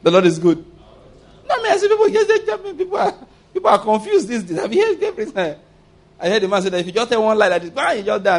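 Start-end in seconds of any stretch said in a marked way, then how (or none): 1.5-5.6 high, laughing incredulous outburst; laughing disbelief. Real, not acted. Preacher laughing at confused people, high incredulous laughter.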